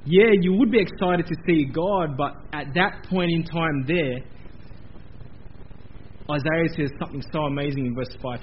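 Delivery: usual speaking voice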